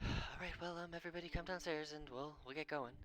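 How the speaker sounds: mother's voice. Acted. GM voicing Ursa.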